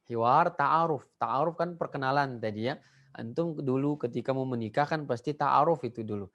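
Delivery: ordinary delivery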